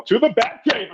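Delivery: high, bright mock-heroic call; hammed-up superhero catchphrase; playful Batman line. Fan joking with Adam West as he hits the button, high mock-heroic.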